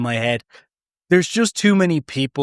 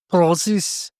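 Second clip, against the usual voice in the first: put-on word prozzies in a Scottish accent, rolled and bouncy; 4 semitones higher than usual. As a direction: say prozzies like a Scot. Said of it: "It's great when you say it in a Scottish accent".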